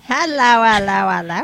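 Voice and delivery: loud hammed-up hellos; mock hello shout. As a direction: belt out hellos playfully. Group mock Italian hellos.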